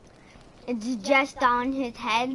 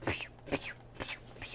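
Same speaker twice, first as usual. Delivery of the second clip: voiceless "pshh" noises; airy imitated bursts; imitated whooshing; 10 dB quieter than usual. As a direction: mouth repeated soft pshh sounds. Imitates a noise while showing off.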